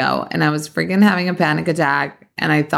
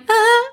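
mock strained ah; 3 dB louder than usual. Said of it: "And I'm like".

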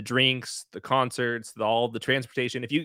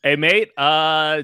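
loud, higher playful Australian greeting; mock Australian accent; 5 semitones higher, 8 dB louder, much slower than usual. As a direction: greet them like an Aussie, put on for fun. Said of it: "just trying to lose this accent".

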